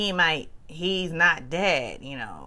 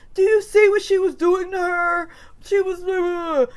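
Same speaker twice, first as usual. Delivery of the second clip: mock protest; 11 semitones higher, 6 dB louder than usual. Kyle protesting, mimicked.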